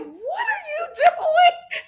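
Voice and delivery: high, slow, laughing yell; laughing disbelieving shout; incredulous shout. Retold or imitated. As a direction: shriek it out in disbelief, half laughing. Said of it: "I was just like".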